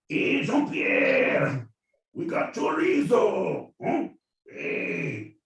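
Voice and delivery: high, slow, loud shout across the field; taunting shout. Pretend. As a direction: call out to taunt the enemy. Soldier shouting to French.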